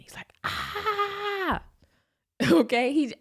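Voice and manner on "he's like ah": high, piercing imitated scream; a sudden shrill battle yell; kid's scream. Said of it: "he just starts screaming".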